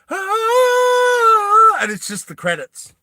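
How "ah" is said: high, loud held battle cry; epic battle cry. Acted. Mock epic cry over the credits, very high and loud. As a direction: roar it like movie drums. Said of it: "it's like".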